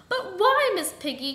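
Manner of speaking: high, loud cry falling away; frightened, pleading question; terrified cry. A narrator's reading. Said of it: "cried the terrified goose".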